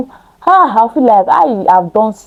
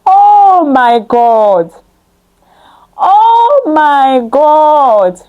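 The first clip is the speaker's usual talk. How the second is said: crying prayer; 4 semitones higher, much slower than usual. Acted. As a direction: wail it out slowly to God. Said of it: "I prayed".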